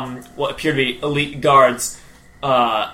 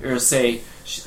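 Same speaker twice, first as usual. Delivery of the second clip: normal talking voice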